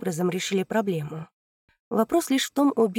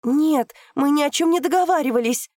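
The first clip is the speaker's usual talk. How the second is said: high, loud desperate cry; girl denies the plot, shouting in despair; 8 semitones higher, 7 dB louder than usual. Acted. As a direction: scream the denial in despair. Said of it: "в отчаянии закричала девушка".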